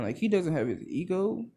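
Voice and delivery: usual speaking voice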